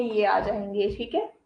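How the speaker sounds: usual speaking voice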